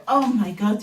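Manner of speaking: ordinary talk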